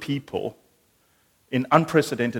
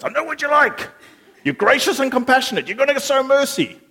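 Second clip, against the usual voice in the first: whiny Jonah; 14 semitones higher, 8 dB louder than usual. Acted. Jonah complains to God.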